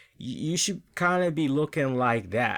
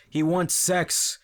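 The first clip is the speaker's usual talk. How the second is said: mocking rant; 4 semitones higher than usual. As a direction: pile it on with rising mockery. Mocking Rusev's comedic storyline.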